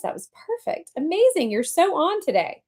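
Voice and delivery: high-pitched, excited praise; gushing director. A narrator's reading. Director praising his star after the take, gushing.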